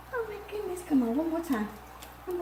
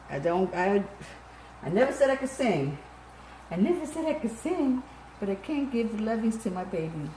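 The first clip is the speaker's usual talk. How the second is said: usual speaking voice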